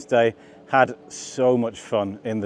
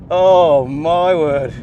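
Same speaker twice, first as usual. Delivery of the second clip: slow, drawn-out, louder amazed cry; excited; 3 semitones higher, 6 dB louder, much slower than usual. Real, not acted. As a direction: stretch it out in amazement. Thrilled driver on hot lap.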